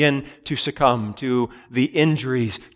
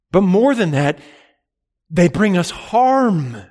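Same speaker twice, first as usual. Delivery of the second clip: fervent preaching; 7 semitones higher, 5 dB louder than usual. Acted. Preacher warning his congregation, raised and fervent. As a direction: proclaim it like a pulpit climax. Preacher building to full force.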